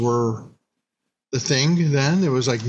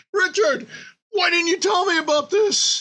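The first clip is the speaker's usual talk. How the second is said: much higher, playful complaint to a friend; mock accusing whine. Teasing his friend Richard for not telling, high mock whine. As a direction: whine it up high like you've been wronged. Confronting friend who recommended show.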